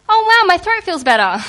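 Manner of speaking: high, loud, overdone surprise; mock amazement. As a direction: ham up the sudden relief. Teen mock surprise after water.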